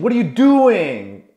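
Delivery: high, loud, slow exasperated scolding; acted angry father losing patience; frustrated dad. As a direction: demand answers from a hopeless son.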